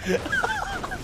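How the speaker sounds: real screaming laughter; howling laughter. Real, not acted. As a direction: lose it completely at the fart. Cracking up at friend's fart.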